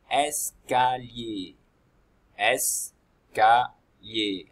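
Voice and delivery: "Escalier" said very slowly, es-ca-lier, each syllable apart; slow syllabified word. Shown as a demonstration. Teacher breaking the word into syllables for pupils, very slow. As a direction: drag out "Escalier" syllable by syllable for children. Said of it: "Ça c'est un escalier".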